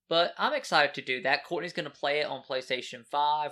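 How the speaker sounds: usual speaking voice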